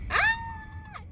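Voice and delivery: sudden high shriek; startled scream. Real, not acted. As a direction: shriek in surprise.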